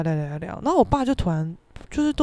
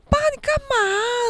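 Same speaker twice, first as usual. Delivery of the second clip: startled; 14 semitones higher than usual. Retold or imitated. Son to weeping father, startled high cry. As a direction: wail it in alarmed bafflement. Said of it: "我吓到".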